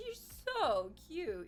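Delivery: ordinary talk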